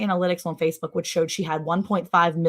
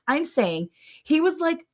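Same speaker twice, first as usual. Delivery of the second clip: ordinary talk, nothing marked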